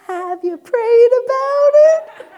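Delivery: high, loud, slow teasing echo of mom; hammed-up mock of mom's pious question; mocking mom's line. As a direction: throw mom's words back at her, sing-song. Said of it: "come back with the".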